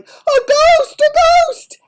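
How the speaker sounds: high, very loud shriek; panicked yelling. Acted. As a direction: yell it like a terrified crowd. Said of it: "with everybody yelling".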